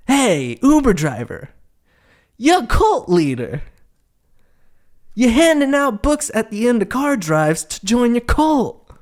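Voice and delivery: ranting at the absent driver; mock accusation. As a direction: accuse him like you've caught him. Calling out the driver.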